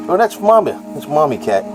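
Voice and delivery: normal talking voice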